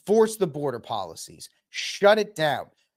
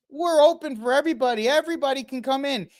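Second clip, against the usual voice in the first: higher, fast, sneering parody; mocking open invitation; 8 semitones higher, faster than usual. Mimicking politicians inviting migrants, high and sneering. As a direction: send up the welcome in a high, quick voice. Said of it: "call out and say".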